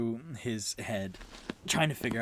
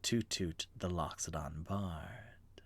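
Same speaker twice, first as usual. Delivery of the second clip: hushed fey farewell; 8 dB quieter than usual. Acted. Fey's parting whisper.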